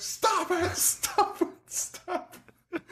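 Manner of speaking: laughing falsetto stop it; mock shrieking girl. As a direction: squeal it like a panicked daughter. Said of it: "the daughter's screaming at the top of her lungs".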